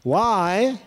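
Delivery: ordinary delivery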